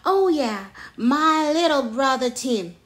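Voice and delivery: loud, lightly acted grumble; mild grumble. A narrator's reading. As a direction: grumble about a little brother, playfully. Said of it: "grumbled Jim".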